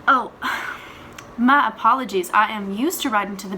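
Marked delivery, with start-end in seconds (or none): 0.4-1.3 breathy, voiceless sigh. Acted. Mother catching her slip in a recorded message, sighing. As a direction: let out a long breath. Breaks off, realizing a mistake.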